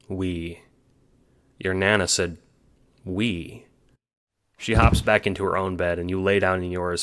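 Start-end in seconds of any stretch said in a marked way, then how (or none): 0.1-0.6 much softer, stunned; dazed soft repeat; stunned murmur. A narrator's reading. Stunned repetition. 3.0-3.6 much softer, stunned; dazed soft repeat; stunned murmur. A narrator's reading. Stunned repetition.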